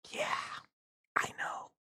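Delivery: hushed, voiceless imitation of your own reply; whispered reply. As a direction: act out a whispered admission. Acted weary reply to radio coworkers.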